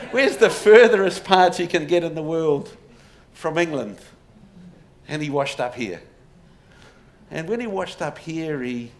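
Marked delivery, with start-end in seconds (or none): 0.1-4.0 put-on louder second go at an Irish accent; Irish accent retry. Lecturer retrying the Kerry rebels' line in a louder Irish accent. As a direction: push the Irish brogue, hammed-up and louder. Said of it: "That's a terrible Irish accent".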